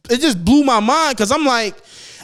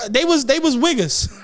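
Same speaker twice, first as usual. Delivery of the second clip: ordinary talk, nothing marked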